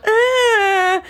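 high, loud frightened cry; startled child squeal. Retold or imitated. Mother imitating her daughter's startled squeal, high and loud. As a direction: squeal like a shy toddler. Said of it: "And she would go".

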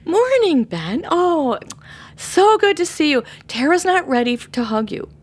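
high, loud, cheery welcome; bright greeting to a child. Retold or imitated. Mother greeting an eager boy, high and bright. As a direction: greet the kid brightly but firmly. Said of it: "And I would say".

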